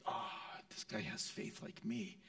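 hushed, awed whisper. Retold or imitated. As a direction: breathe it like a secret of recognition. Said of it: "It's like".

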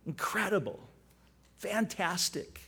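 lightly voiced cheerful friend; upbeat answer. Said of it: "he'll respond by saying something like this".

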